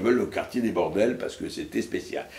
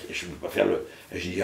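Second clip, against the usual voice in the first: normal talking voice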